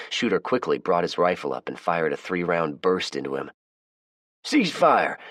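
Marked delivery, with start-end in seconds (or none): 4.4-5.2 loud, high command; shout. A narrator's reading. Justin stopping the shooting, loud high shout. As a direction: bark the order across the yard. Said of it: "Justin shouted".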